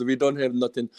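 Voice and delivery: ordinary talk, nothing marked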